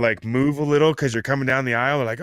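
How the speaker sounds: normal talking voice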